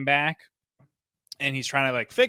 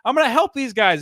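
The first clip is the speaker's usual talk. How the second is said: put-on eager young hero; 10 semitones higher, 7 dB louder than usual. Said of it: "Peter was like".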